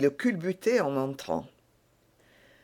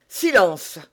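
stern command; 3 semitones higher, 6 dB louder than usual. A narrator's reading. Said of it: "dit Athos".

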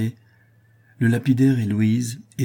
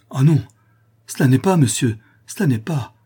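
loud, high, vehement denial; emphatic, urgent outburst; forceful cry; 6 semitones higher, 7 dB louder than usual. A narrator's reading. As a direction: protest with all your strength. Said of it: "s'écria Louise avec force".

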